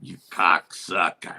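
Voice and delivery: low, slow Russian-voiced curse; imitated Russian. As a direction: curse slowly and deep, mimicking the Russian. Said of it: "He drops, he goes".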